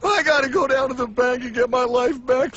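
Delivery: ordinary talk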